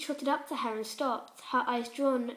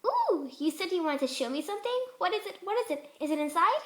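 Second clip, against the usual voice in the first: high, bouncy pony voice; eager, impatient questions; excited party pony pitched up; Pinkie Pie voice; 6 semitones higher than usual. Acted.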